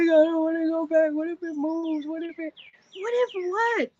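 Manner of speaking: high, panicky mock wail; crying protest. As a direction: act out a tearful, frightened refusal. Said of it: "The entire time I was crying".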